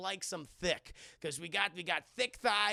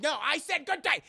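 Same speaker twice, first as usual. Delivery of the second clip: high, loud final dismissal; hammed-up indignant refusal; emphatic mock shout; 7 semitones higher, 8 dB louder than usual. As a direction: cut them off with a shouted good day. Jack repeats his refusal.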